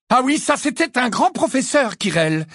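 high, loud cutting-in; loud, high-pitched retort; loud interruption. A narrator's reading. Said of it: "l'interrompit Harry à voix haute".

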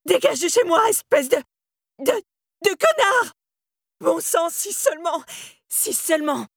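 high, verging on hysteria; hysterical. A narrator's reading. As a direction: scream and sputter, losing control. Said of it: "Celle d'Angela frisait l'hystérie".

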